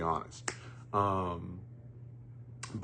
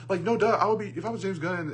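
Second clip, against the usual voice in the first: high, loud exasperated outburst; 11 semitones higher, 6 dB louder, faster than usual. Real, not acted. Reviewer imagining himself as the studio head, exasperated. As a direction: burst out as if it's painfully obvious. Exasperated hypothetical rant as Gunn.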